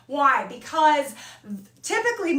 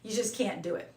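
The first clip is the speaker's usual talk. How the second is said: low, soft mock verdict; mock teacher giving up; 5 semitones lower, 5 dB quieter than usual. Teacher imitating herself telling a client to give up, low and soft. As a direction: lightly play the teacher who quits. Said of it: "we just said".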